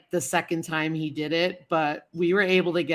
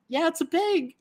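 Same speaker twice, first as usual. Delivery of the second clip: high chirpy reply; 11 semitones higher than usual. Retold or imitated. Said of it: "And I'm like".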